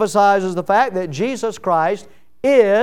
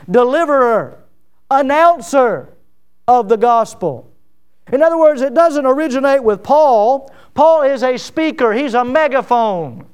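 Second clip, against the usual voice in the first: preaching loud; 5 semitones higher, 5 dB louder than usual. Real, not acted. Preacher building to climax.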